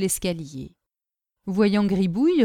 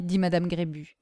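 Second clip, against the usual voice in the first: plain reading voice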